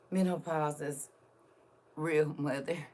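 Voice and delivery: normal talking voice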